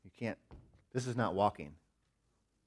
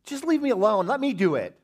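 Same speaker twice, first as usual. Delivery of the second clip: put-on high, loud childish protest; whiny kid; 13 semitones higher, 10 dB louder than usual. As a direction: demand to be left alone. Son insisting.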